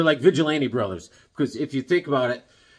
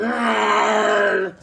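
high shouted monster roar; Bigfoot roar; 8 semitones higher, 7 dB louder than usual. Retold or imitated. Bigfoot in the Himalayas roaring at Atom Smasher, a high, loud roar.

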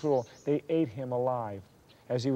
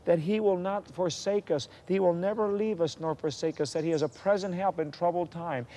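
raised, emphatic scripture declaration; fervent preaching; 6 semitones higher than usual. Acted.

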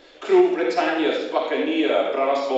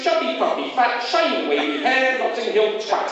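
punchy rhythmic list recitation, mostly higher and building; performance poem; 7 semitones higher than usual. Acted. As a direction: fire off each slogan word like a beat in a ranting poem. Performance poem of slogan lists.

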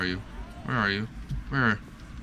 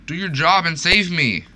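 high, loud call for help; yelling at the guardian mob to save him; yelling demand; 8 semitones higher, 7 dB louder than usual. Real, not acted.

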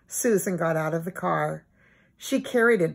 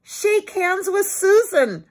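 put-on high, louder eager boy voice; proud boy command; 12 semitones higher, 6 dB louder than usual. A narrator's reading. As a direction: proudly tell the dog to shake. Timmy pleased and proud.

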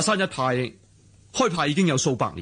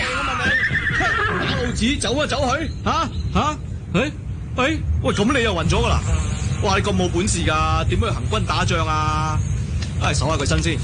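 disguised as officer; 12 semitones higher than usual. Acted. Disguised swordsman blustering at a rider as officer. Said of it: "扮呢個軍官都幾有趣啊".

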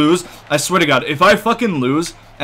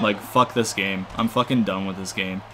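low, soft grumble; quietly fed up with it all; defeated resignation; 7 semitones lower, 7 dB quieter than usual. Real, not acted. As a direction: give up, energy drained from the voice. Gives up on the game.

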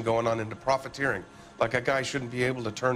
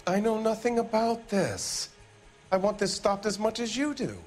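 higher-pitched, insistent denial; indignant protest; 9 semitones higher than usual. Acted. Factory boss denies involvement to the police, indignant protest. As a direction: protest innocence with a raised pitch.

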